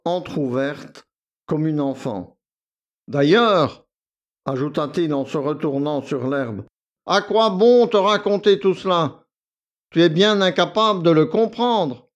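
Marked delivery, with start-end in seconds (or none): 3.1-3.8 loud, lofty interjection; haughty and loud; dismissive. A narrator's reading. Condescending valet breaks off. 7.0-12.1 high, loud, belittling; haughty dismissal; contemptuous. A narrator's reading. Valet belittles the girl.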